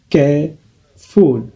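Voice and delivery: "careful" said very slowly; slow model word. Shown as a demonstration. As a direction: stretch out "careful" for learners. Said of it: "deux syllabes". ("careful" prononcé très lentement)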